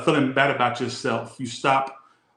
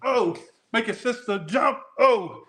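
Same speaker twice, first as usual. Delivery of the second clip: high, slow, hammed-up gospel call to jump; lively gospel preacher; 9 semitones higher than usual. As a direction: rouse the congregation to jump.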